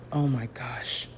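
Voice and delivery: hushed awe. Real, not acted. Admiring her own finished painting.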